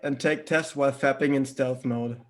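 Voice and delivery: ordinary talk, nothing marked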